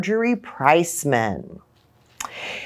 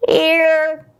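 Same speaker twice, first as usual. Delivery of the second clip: put-on high, small child's voice; student says here; kid answering roll; 9 semitones higher, 4 dB louder than usual. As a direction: pipe up like a little kid.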